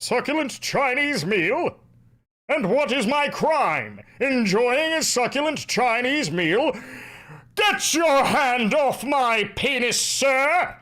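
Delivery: high, slow, booming declamation; hammed-up outraged thespian under arrest; theatrical indignant orator. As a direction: protest grandly, stretching every word. Acting out famous indignant arrest speech.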